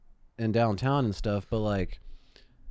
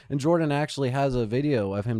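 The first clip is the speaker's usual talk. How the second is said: usual speaking voice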